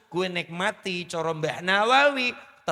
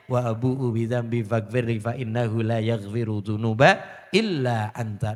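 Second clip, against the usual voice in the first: lower, chanted Arabic prayer; lower, melodic recitation of the Sayyidul Istighfar; 4 semitones lower, 3 dB louder than usual. Acted. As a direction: recite it devoutly, in a measured chant.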